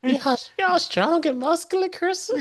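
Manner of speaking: Herbert the pervert. Acted. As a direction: draw it out in a cartoon creep's high voice. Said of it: "Herbert the pervert".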